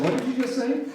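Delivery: can't believe what he just heard; a jolt of disbelief in the voice; stunned disbelief. Retold or imitated. Stunned reply to the driver.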